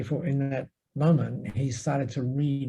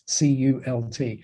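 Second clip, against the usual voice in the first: normal talking voice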